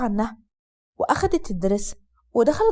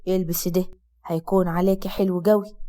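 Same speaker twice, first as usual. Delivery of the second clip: lowered, steady man's voice; Asad's low male voice; 6 semitones lower than usual. Acted. Husband comforting his sick wife, low male voice. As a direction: speak as the calm husband, low.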